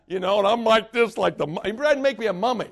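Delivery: ordinary delivery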